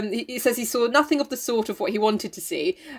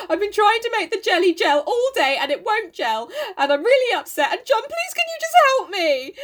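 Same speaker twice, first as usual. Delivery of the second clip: mock whiny; 9 semitones higher than usual. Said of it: "And Meg says".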